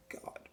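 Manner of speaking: soft, breath-heavy sigh of exasperation; exasperated sigh. Real, not acted. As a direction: let out a hushed, exasperated sigh. Exasperated at his own rant.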